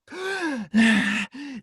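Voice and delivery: sharp high gasp for air; surfacing gasp. Shown as a demonstration. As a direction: gulp air like breaking the surface. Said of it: "they're back on the surface".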